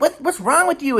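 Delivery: very high scolding mom voice; mom berating. Retold or imitated. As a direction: mimic mom snapping at him. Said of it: "she's like".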